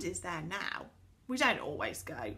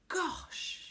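high, breath-filled gasp of wonder; amazed; 11 semitones higher than usual. A narrator's reading. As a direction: breathe it out in awe. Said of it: "enjoying Connie's surprise".

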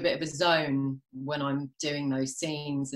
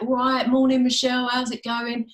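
put-on strong East London accent, higher and louder; 8 semitones higher, 5 dB louder than usual. British crew member greeting the actress on set, strong East London accent. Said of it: "his accent was really, really strong, really sort of East London".

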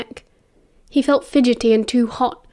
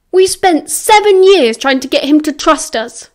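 raised, loud remonstrance; heated argument; 5 semitones higher, 6 dB louder than usual. A narrator's reading. James gesturing wildly as he argues with Sirius, heated. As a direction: argue it hard, higher and louder. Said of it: "gesturing wildly with his hands".

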